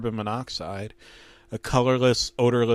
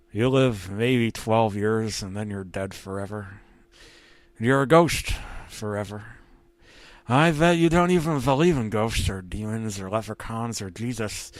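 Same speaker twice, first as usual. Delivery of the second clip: ghost voice. Acted. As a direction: menace them like a ghost. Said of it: "he had a voice. Didn't sound like that Tom".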